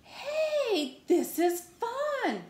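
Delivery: very high, drawn-out glee; delighted discovery. Acted. Pigeon finding the bath fun, high delighted squeal.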